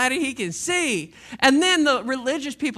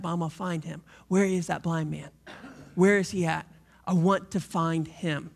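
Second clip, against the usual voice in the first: lower, softer, steady resolve; a quiet seeker on a mission; determined Jesus on a mission; 8 semitones lower, 3 dB quieter than usual. Acted. Jesus voiced searching for the man.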